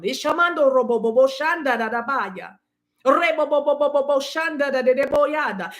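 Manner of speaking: flowing chanted tongues syllables; speaking in tongues. Real, not acted. Reader breaking into tongues over her listeners. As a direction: let the tongues pour out.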